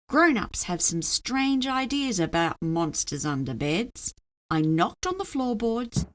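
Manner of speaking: plain reading voice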